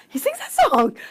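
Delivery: high, loud astonished realisation; mounting amazement. Retold or imitated. Husband recognising yet another hit, astonished.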